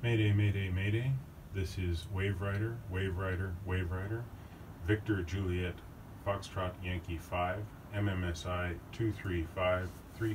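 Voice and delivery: calm, quiet, measured distress call; mayday radio call. Shown as a demonstration. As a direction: read it out like a VHF radio mayday. Practising VHF mayday call.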